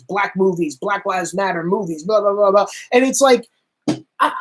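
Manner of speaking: usual speaking voice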